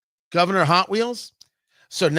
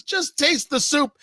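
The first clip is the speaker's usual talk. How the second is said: insistent; 6 semitones higher than usual. Acted. Man insists a third time.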